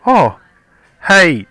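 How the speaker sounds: a sudden loud, high mock-cheery hello; over-the-top greeting. Husband hailing the gardener in mock delight, loud and high. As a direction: greet him like a long-lost mate, loud and high.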